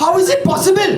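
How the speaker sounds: very high, loud scandalised wail; mock outrage. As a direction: wail it like a shocked aunty. Mock gossip outrage banter.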